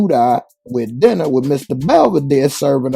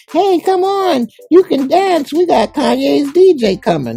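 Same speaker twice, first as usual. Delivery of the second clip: mocking party girl; 6 dB louder than usual. Voicing party girls inviting Jake.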